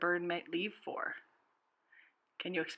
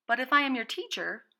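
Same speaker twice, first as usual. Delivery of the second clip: higher, louder bird cutting in; interrupting bird; 6 semitones higher, 8 dB louder than usual. A narrator's reading. Bird interrupting the dog, high and loud. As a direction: jump in over him, high and eager. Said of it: "the bird interrupted".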